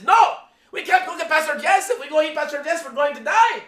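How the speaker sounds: high, loud mosquito voice in Filipino English; Filipino accent. Retold or imitated. Mosquitoes plotting to bite the pastor, loud high Filipino accent. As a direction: play a mosquito calling its friends, Filipino accent. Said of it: "he used the Filipino accent".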